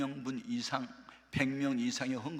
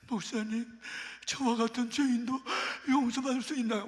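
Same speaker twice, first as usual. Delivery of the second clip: higher, pleading, tearful voice; tearful plea; 11 semitones higher, 4 dB louder than usual. Retold or imitated. Repentant woman pleading with the pastor, higher and tearful. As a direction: beg for forgiveness through tears, mildly acted. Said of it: "막 엉엉 우는 거야".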